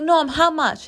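put-on high coaxing question to a little kid; playful high-voiced aunt; aunt asking nephew. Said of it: "And I was like".

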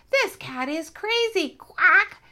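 high, loud squawking duck chant; loud quacking ducks. Acted. Ducks circling the cat, squawking loudly and high. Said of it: "quacking loudly and saying over and over".

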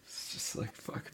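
breathy whisper of a curse; whispered, resigned expletive. Retold or imitated. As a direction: drop to a whisper for the swear. Scene cut, muttered reaction.

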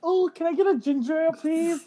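high, quick little voice; tiny high-pitched put-on voice; meek mock customer. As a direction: squeak out a polite request. Joke timid drink order at card table.

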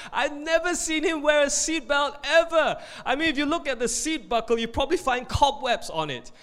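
high, emphatic wifely tattling; exasperated wife. Acted. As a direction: pile on the complaint, pitched high. Wife contradicts husband about seatbelt.

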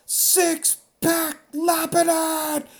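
high, slow, stretched announcer call; game show host. Retold or imitated. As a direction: draw out the name like a TV host introducing a star. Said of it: "I just hear the host go".

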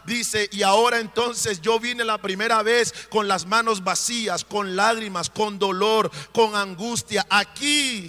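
loud, rising preacher's cry, voicing Hannah's prayer; preaching at full force. Preacher voicing Ana's grateful prayer to the priest, impassioned preaching.

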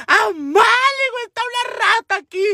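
very high hysterical scream; re-enacted shriek for mother; crazed panic. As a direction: squeal it like a terrified madwoman. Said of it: "yo parecía loca".

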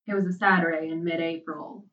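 ordinary delivery, nothing marked

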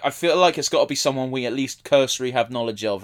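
ordinary delivery

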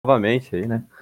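ordinary delivery

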